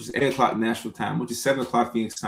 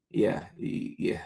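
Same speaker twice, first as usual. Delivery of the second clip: breathy whisper, very soft; a soft conspiratorial whisper; whispered yeah; 3 semitones lower, 7 dB quieter, slower than usual. Acted. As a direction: drop to a hushed whisper.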